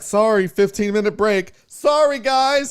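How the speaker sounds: high singsong mock apology; dismissive clerk. Imitating the clerk brushing off the line, singsong. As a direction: toss off a fake sorry. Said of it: "the lady was like".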